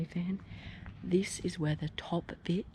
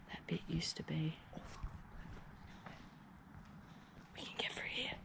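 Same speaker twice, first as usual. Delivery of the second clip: soft night whisper; 12 dB quieter than usual. Real, not acted. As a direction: whisper so nobody hears. Sneaking at night.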